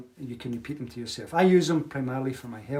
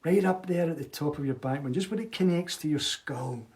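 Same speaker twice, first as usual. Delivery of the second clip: guided meditation. Acted. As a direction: guide them gently inward, letting it sink. Leads a smiling meditation.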